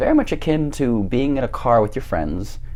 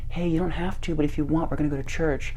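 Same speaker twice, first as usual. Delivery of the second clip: soft, higher motherly voice; imitated gentle mom; 8 semitones higher, 4 dB quieter than usual. Friend's mom waking a guest, gently imitated. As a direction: coax softly in a raised voice.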